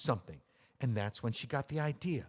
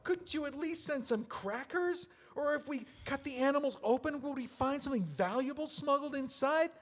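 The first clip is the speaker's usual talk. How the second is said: bitter rant; 8 semitones higher than usual. Acted. Bitter rant about the dolls.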